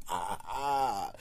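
slow hammed-up mock laugh; slow gloating fake laugh. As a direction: cackle like a smug show-off. Mocking a braggart's laugh.

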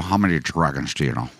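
Sean Connery. Acted. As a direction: drop low into a Scottish movie-star voice. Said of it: "a Sean Connery voice".

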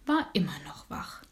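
whispered, dropping away; hushed refrain. A narrator's reading. Narrator tells the children the dormouse is still awake, whispered. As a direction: breathe it out like a secret at bedtime.

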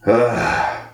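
acted breathy groan; stunned owner's ugh. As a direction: let out an imitated ugh.

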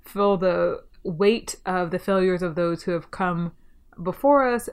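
ordinary delivery

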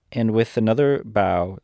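ordinary delivery

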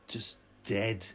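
ordinary delivery

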